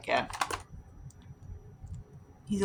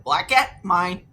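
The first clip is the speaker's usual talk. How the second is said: playful claim; 3 semitones higher, 8 dB louder, slower than usual. Retold or imitated. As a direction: shout it out to claim the cat. Calling out to claim the cat.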